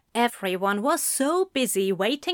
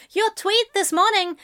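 journalist voice; 11 semitones higher than usual. A narrator's reading.

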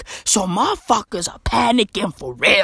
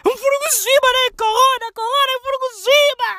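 much higher, fast, squealing wail; 13 semitones higher, much faster than usual. Retold or imitated. Mocked man wailing he can't swim. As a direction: squeal in terror about swimming. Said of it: "mocked man wailing".

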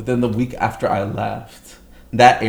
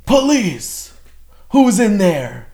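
high, loud mock police yell; police shouting; 10 semitones higher, 7 dB louder, much slower than usual. Police officer shouting into the apartment, loud mock yell. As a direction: bark it like a cop at the door.

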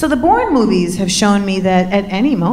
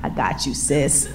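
low and soft, much quieter; low murmur; 8 semitones lower, 7 dB quieter than usual. Retold or imitated. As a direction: drop low and soft, reassuring. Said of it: "in his mind he thought".

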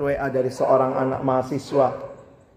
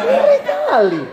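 much higher laughing exclamation; laughing squeal; 4 dB louder, slower than usual. Real, not acted. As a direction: squeal with disbelief. Preacher squeals at absurd quote. (pekikan tertawa yang tinggi)

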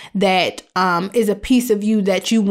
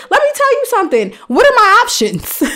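much higher and louder, fired up; excited emphasis; 11 semitones higher, 3 dB louder than usual. Acted. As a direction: hit them with the punchline. Emphatic punchline to audience.

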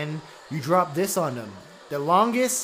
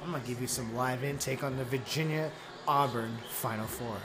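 announcer voice; 4 semitones lower, 6 dB quieter than usual. Acted. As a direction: call the game like a radio host. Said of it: "How you like that announcer voice?".

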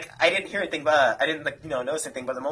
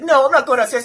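louder, higher stubborn no; defiant refusal; 9 semitones higher, 8 dB louder than usual. Retold or imitated. Mimicking the friend refusing to go downstairs, loud and stubborn. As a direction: dig in and refuse.

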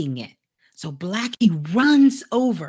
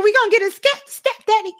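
much higher and louder, jumping with excitement; excited kids; 12 semitones higher, 6 dB louder, faster than usual. Acted. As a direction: squeal it like thrilled kids. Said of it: "the kids was all excited".

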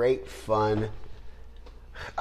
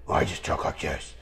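much lower, growly mock Batman voice; mock gruff Batman; 8 semitones lower, 5 dB quieter than usual. Mocking Batman's disguised voice, lowered growl. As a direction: drop into a fake hero growl. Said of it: "How about I have a voice changer?".

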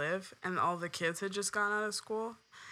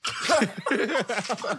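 a laugh bursting out; stifled giggles; 4 semitones higher, 6 dB louder than usual. Real, not acted. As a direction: giggle caught in the throat. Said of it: "Try not to laugh".

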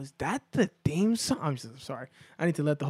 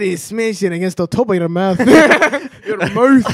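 loud Irish mimicry through laughter; laughing, exaggerated Irish brogue; mock Irish accent; 4 semitones higher, 11 dB louder than usual. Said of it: "it turned Irish".

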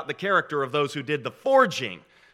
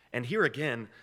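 ordinary delivery

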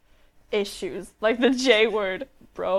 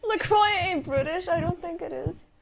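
mock British accent; 7 semitones higher than usual. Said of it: "They say".

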